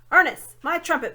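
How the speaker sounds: loud call for his trumpet. A narrator's reading. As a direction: bellow for the servant. Dwarf calls for ear trumpet.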